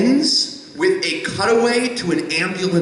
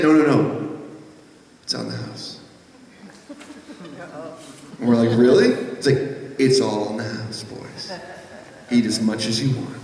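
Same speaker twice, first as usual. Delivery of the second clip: a touch of Southern host; 7 semitones lower than usual. Retold or imitated. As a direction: wave off their money gently. Big Daddy offers free food.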